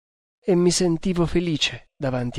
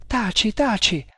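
higher-pitched, broken-voiced plea; 7 semitones higher than usual. A narrator's reading. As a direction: plead for silence with the voice breaking. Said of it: "con voce rotta". (con voce rotta, supplichevole)

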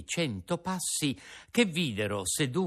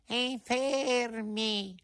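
much higher, very slow wail; echoing cat; 13 semitones higher, much slower than usual. Acted. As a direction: stretch the word like a mewing cat. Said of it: "ripeté il gatto".

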